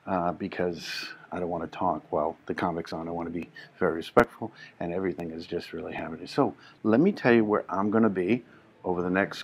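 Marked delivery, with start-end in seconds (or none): 0.1-6.3 soft, low and quick; voice kept down. Real, not acted. Host not wanting to talk while the comic's on, voice kept down. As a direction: talk discreetly under a live show. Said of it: "I gotta keep my voice down".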